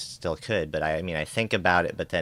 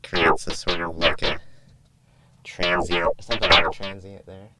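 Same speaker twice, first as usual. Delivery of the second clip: synth voice effect; 4 dB louder than usual. Shown as a demonstration. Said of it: "so this is Synth Voice".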